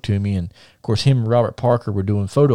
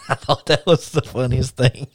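talking through laughter; amused, words bubbling out on a laugh; 3 semitones higher than usual. Real, not acted. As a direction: laugh while telling it. Laughing at his own story.

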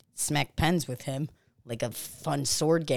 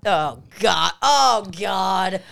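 panicked; 2 semitones higher, 5 dB louder, much slower than usual. Acted. Panics at boss reveal.

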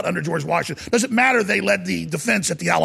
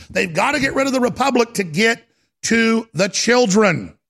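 ordinary talk, nothing marked

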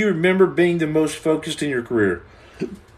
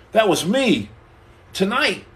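ordinary talk, nothing marked

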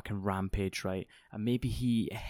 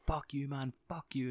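ordinary talk

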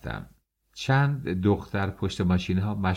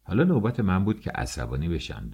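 ordinary delivery, nothing marked

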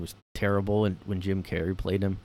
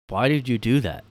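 usual speaking voice

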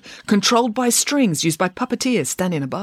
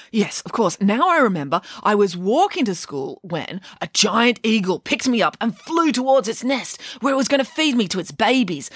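put-on higher, animated little-boy voice; fibbing puppet boy, eager; 4 semitones higher than usual. Puppet lies to the fairy, eager fibbing. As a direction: spin a breathless tall tale brightly. Puppet spins a tall tale.

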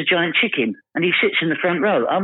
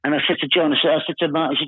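ordinary talk, nothing marked

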